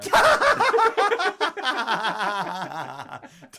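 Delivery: loud, high, helpless laughing fit; burst of laughter. Real, not acted.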